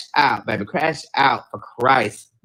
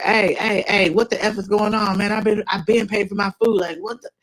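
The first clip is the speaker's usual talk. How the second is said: raised, angry ranting; higher, heated complaint at the counter; going off; 7 semitones higher than usual. Retold or imitated. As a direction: boyfriend going off at the cashier. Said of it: "Like, he's going off".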